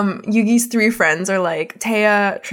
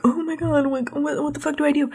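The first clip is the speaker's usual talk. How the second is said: put-on poor panicked kid; 6 semitones higher, 4 dB quieter than usual. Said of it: "this poor kid Yugi is like".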